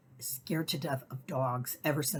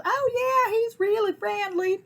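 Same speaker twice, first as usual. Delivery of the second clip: much higher, louder sweet voice; imitating the lady; 7 dB louder than usual. Lady reassuring the narrator about her pit bull, high and sweet. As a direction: play the breezy lady owner. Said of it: "She goes".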